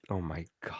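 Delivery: soft breathless oh my God; whispered disbelief. Real, not acted. Stunned that John won the week, whispered disbelief.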